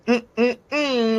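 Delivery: wordless shushing refusal; disapproving hum. Retold or imitated. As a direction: hum a warning no. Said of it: "the spirit went".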